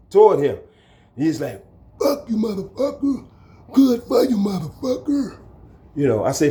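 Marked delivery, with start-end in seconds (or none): 2.0-5.4 high, sneering put-on voice of the thrower; defiant inmate voice. The thrower curses him out, high and sneering.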